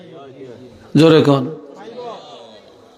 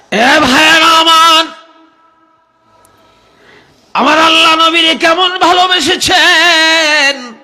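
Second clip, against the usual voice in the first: very high, loud sustained call; impassioned preaching cry; 12 semitones higher, 6 dB louder than usual. Real, not acted. Preacher calling his brothers to God's love, high ringing cry. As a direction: cry out at the top of the voice.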